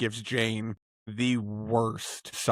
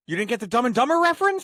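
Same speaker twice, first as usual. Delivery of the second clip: incredulous; 11 semitones higher, 4 dB louder, much faster than usual. Real, not acted. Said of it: "friends teasing".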